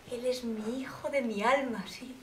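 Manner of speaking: tender whisper about her son. Acted. Mother whispering over her son's photo in the wallet. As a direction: breathe it lovingly.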